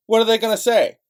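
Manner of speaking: furious rhetorical shout; angry shout. Real, not acted. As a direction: yell the question out in frustration. Ranting at the audience.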